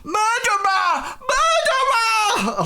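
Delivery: high, drawn-out cry of accusation; accusing shout. Acted. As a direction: scream it out at the culprit, stretched long. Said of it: "he points at him, and he says".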